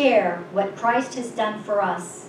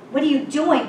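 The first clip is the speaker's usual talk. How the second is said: raised, pressing demand, a touch of alarm; mild rage; 6 semitones higher, 4 dB louder than usual. A narrator's reading. Concerned cave man challenges the stranger, mildly raging. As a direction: voice a concerned man's challenge, higher. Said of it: "one concerned man raged".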